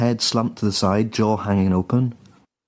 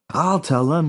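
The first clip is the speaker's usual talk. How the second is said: "I'll tell them" pitched higher with a rising end; higher insistent repeat; 6 semitones higher, slower than usual. A narrator's reading. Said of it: "he repeated".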